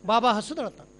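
low reply in patter; showman's answering part. Acted.

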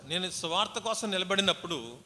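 loud, slow, stretched preaching of the verse; fervent scripture reading. Acted. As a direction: proclaim Paul's plea with power. Reads Paul's plea preached. (గట్టిగా, నెమ్మదిగా వాక్యం చదవడం)

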